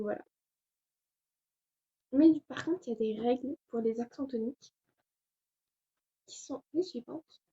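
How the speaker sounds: usual speaking voice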